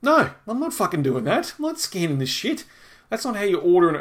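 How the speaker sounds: indignant refusal. Retold or imitated. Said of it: "I'm like".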